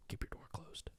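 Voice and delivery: hushed spooky whisper. Pretend. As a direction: breathe it into the mic. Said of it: "I'll just keep whispering into the mic".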